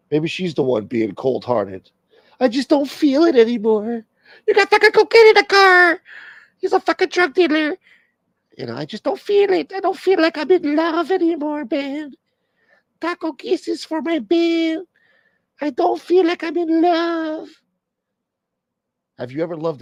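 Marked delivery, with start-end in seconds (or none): 2.4-7.8 high, loud whiny woman's voice; mock J-Lo. Mocking [name]'s complaint. 8.7-17.5 mock J-Lo. J-Lo voice continues.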